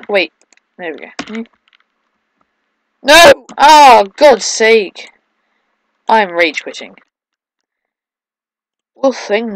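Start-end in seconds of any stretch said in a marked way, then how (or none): 3.0-5.1 loud, high shout easing down in exasperation; exasperated outburst. Real, not acted. Player about to rage quit, exasperated shout. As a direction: blow up at the game.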